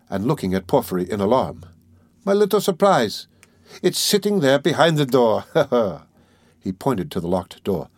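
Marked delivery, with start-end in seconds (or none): 2.2-6.0 high, teasing lilt with a chuckle; playful. A narrator's reading. Examining lawyer teasing about the locked door, playful. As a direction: reveal it gleefully, then chuckle. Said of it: "more playful and good-humored".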